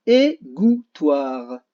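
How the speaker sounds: drawn out slowly, syllable by syllable; slow syllable demo. Shown as a demonstration. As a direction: stretch each syllable for the children. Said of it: "Là où il y a le son G". (très lent, syllabe par syllabe)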